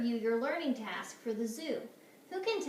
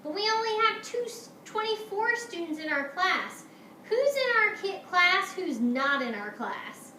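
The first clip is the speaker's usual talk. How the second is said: high, loud, puzzled teacher; bewildered, raised-voice questioning; exclaiming; 8 semitones higher, 6 dB louder than usual. Acted. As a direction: protest in rising alarm. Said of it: "Missus Hartwell exclaimed".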